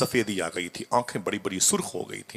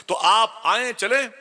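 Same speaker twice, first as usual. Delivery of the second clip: urgent summons; 8 dB louder, slower than usual. Acted. Messenger urgently summons the scholar.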